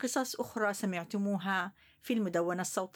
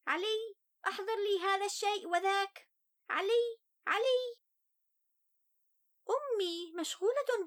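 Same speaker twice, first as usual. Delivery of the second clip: put-on higher young boy's voice, sulky; child voice; 9 semitones higher than usual. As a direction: tell it as an upset little boy. First-person child narrator Ali.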